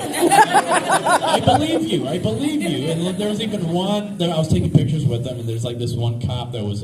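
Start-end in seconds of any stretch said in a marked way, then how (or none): 0.0-2.9 laughing high, then settling; laughing nervous relief; laughing agreement. Retold or imitated. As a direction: chuckle through the words. Laughing reply to cops.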